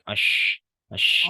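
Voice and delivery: a teacher drilling the letter shin with sukun; hushed sh sound. Shown as a demonstration. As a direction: sound the sh of "ash" as a broad steady rush of air. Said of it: "Yang penting jangan monyong banget".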